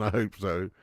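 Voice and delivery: normal talking voice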